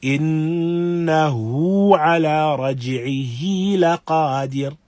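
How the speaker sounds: slow recitation, the ه of إنه and رجعه held for two counts; Quran recitation. Shown as a demonstration. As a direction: recite إنه على رجعه لقادر evenly, two-count stretch on each ه. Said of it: "Again, giving emphasis to these two places".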